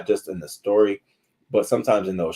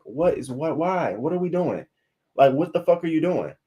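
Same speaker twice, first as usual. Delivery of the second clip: higher-pitched incredulous questions; appalled disbelief; 8 semitones higher than usual. Retold or imitated. Reacting to the man kicking his dog, higher appalled disbelief. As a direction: stare at him like he's lost his mind.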